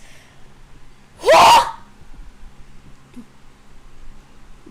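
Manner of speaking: loud, high gasp; shocked gasp. Real, not acted. Shocked reaction while reading.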